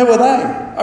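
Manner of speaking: imitating teens. Pastor mimics youth group kids not knowing the Beatles, high mock confusion. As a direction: play the clueless kid who never heard of them. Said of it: "Here it comes".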